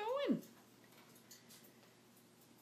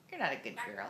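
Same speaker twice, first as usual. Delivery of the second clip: playful scolding in a much lower voice; mock scolding; 10 semitones lower, faster than usual. Owner mock-scolds her parrot in a low teasing voice. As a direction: drop the voice low and tease the bird.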